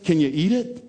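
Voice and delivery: put-on high, eager little-kid question; imitated young daughter. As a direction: mimic a small child asking daddy. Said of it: "You know what she says?".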